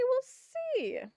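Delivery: jumps way up in pitch; high sing-song. Real, not acted. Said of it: "So we'll see".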